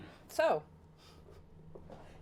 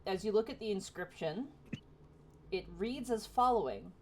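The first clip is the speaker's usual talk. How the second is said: ordinary talk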